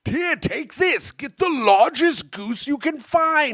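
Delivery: high, slow, theatrical miser; Ebenezer Scrooge. Acted. Miser sends a passer-by for goose, hammed-up. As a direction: grandly order it, stretching the words. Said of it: "Do you think it was Ebenezer Scrooge".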